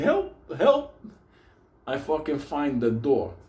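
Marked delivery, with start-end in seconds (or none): 0.0-0.9 high, thin hammed-up call for help; mock cry for help. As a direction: squeal for help like you're lost in the dark.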